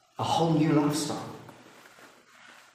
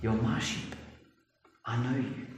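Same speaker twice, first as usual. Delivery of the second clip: tender; 7 dB quieter than usual. Acted. Tender paraphrase of Jesus.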